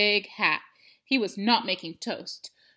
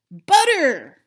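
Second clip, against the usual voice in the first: loud, high cry of "Butter!"; excited discovery; 6 semitones higher, 13 dB louder than usual. A narrator's reading. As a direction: burst out with delight. Pig smells butter.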